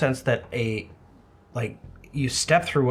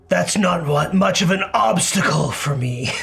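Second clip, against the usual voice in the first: loud, higher villain voice calling back; echoing villain voice; 5 semitones higher, 4 dB louder than usual. Acted. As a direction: boom it back like a distant villain. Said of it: "an echoing voice come back".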